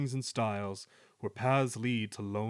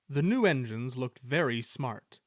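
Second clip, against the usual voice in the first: plain reading voice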